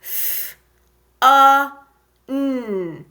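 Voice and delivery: "F, a, n" as separate sounds fff, uh, nnn; breathy F, loud uh for "a", hummed "n"; sounding out. Shown as a demonstration. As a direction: tap out each sound of "F, a, n" with pauses. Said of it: "Let's tap it again".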